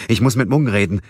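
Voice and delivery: atemlos und lauter, höher; keuchend. A narrator's reading. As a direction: aufgeregt hervorgestoßen. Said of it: "keuchte er". (Gabriel keucht aufgeregt ins Telefon)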